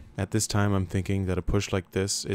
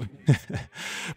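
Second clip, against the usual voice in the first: short laugh; 7 semitones higher than usual. Real, not acted. Laughs at own joke.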